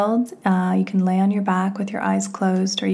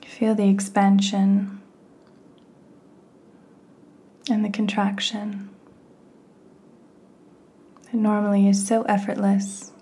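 guided meditation; 5 dB quieter than usual. Real, not acted. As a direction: guide the listener gently inward, phrase by unhurried phrase.

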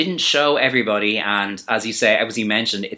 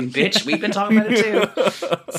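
much higher, slow, laughing sass; sassy retort; 11 semitones higher, slower than usual. Retold or imitated. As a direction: tease the judges with a laugh. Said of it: "I was like".

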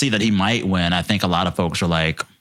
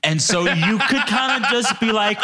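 ordinary talk, nothing marked

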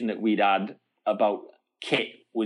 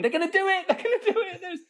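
much higher, laughing with delight; excited shout; faster than usual. Retold or imitated. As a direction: squeal it out running back with news. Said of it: "came running back going".

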